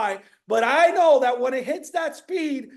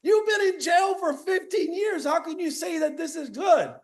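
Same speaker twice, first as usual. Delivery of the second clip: high, shouting disbelief; the king yells at his freed advisor in disbelief; outraged king; 6 semitones higher than usual. Retold or imitated. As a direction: scream it at the advisor. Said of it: "the king goes".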